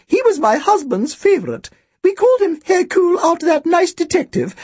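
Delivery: put-on thin, very high old-woman voice; elderly shopkeeper, thin and high; Missus Bodega. A narrator's reading. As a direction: introduce yourself in a birdlike high pitch. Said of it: "Her voice was thin and high-pitched".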